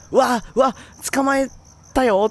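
put-on high, gleeful kid voice; excited little boy. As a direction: squeal it like a proud little boy. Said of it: "少年から".

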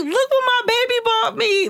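high, mock gushing girly voice; social media bragging. Woman showing off for the gram, mock gushing. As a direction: show it off in a high singsong. Mocking women posting for Instagram.

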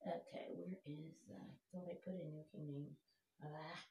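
under-the-breath whisper, searching a device; whispered to himself. Real, not acted. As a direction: whisper it to yourself while hunting for the verse.

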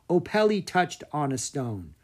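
ordinary delivery

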